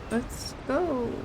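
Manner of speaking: ordinary talk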